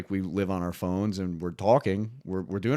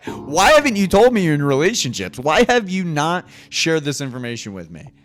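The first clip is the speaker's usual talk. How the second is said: loud, high mock indignation; playful loud complaint; mock outrage; 6 semitones higher, 9 dB louder than usual. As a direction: demand an answer, hammed up.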